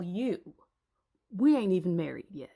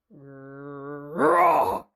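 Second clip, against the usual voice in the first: low growling roar; big animal roar; lion roar; 6 semitones lower, 4 dB louder than usual. Acted. Lion attacks Samson.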